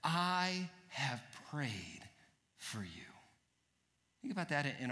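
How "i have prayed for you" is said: slow, much softer, fading; tender reassurance. Acted. Preacher voices Jesus reassuring Peter, slow and hushed. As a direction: comfort them gently and slowly. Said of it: "Jesus says".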